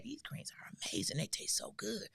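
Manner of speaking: soft whispered delight; whispered praise. Retold or imitated. Daughter imitating mother's whispered praise of the greens. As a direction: whisper it like savoring food.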